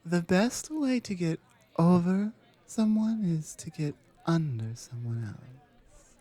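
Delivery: ordinary talk, nothing marked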